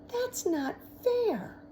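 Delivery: high, loud, slow whine; whiny complaint. Acted. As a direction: drag out the protest like a sulky kid. Girl complaining about vote.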